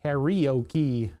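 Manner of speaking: "Karaoke" with stressed ee sounds, the English way; anglicized pronunciation. Shown as a demonstration. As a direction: say "Karaoke" slowly as carry-okie. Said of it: "We added a whole bunch to that word".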